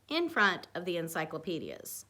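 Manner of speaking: plain reading voice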